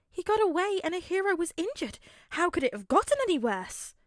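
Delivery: high, disbelieving mother; can't believe what he just said; incredulous mother. A narrator's reading. Inko incredulous.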